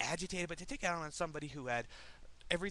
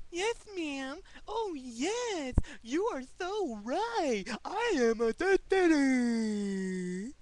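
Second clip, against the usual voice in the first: very high, loud mocking simpleton voice, slow and drawn out; mock subservient idiot; 9 semitones higher, 10 dB louder, much slower than usual. Worker sarcastically playing obedient for a rude customer, mock subservient idiot. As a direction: grovel in an exaggerated dopey falsetto, stretching the last sound.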